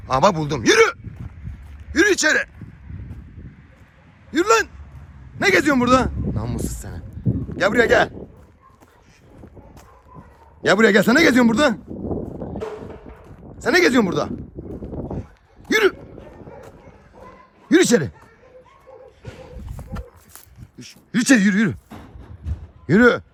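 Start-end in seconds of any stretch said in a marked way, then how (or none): 0.6-6.1 scolding call. Real, not acted. Scolding pet, driving it inside. 7.6-23.2 scolding call. Real, not acted. Scolding pet, driving it inside.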